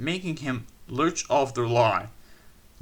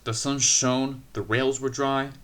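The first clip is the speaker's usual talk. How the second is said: ordinary delivery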